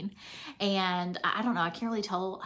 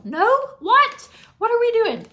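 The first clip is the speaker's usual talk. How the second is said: high, loud mock outburst at a forgetful kid; hammed-up morning-rush frustration; exasperated mom yell; 12 semitones higher, 14 dB louder than usual. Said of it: "Give me, like, two weeks when I'm like".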